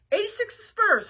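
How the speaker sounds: high, loud waitress holler; short order yelled out. Acted. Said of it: "hollered the waitress".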